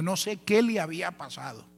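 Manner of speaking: normal talking voice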